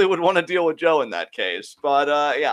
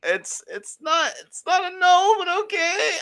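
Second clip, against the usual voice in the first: squeaky, much higher voice; high flustered voice. Acted. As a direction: pitch it way up in mock shock.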